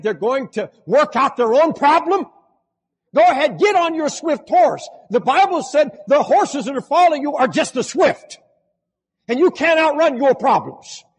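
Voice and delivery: high, loud soaring preacher cry; preaching at full force. Acted. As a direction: drive it home to the crowd with fire.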